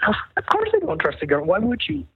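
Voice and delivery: quick mock-incredulous comeback, pitch leaping at the end; incredulous retort. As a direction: fire back as if it's absurd. Acts out incredulous retort to doctor.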